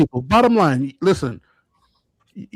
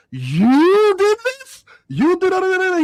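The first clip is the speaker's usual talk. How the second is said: very high, slow, stretched astonishment; amazed disbelief; 4 dB louder, much slower than usual. Retold or imitated. Dennis seeing the kid's art in the elevator, stunned high disbelief. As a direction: drag each word out in total shock. Retold amazed reaction in story.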